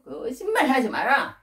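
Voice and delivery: high, drawn-out scolding; stern rebuke. Acted. Ruler accusing his brothers of lying, stern rebuke. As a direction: cut off their excuse with a slow rebuke. Joseph rejects the brothers' excuse.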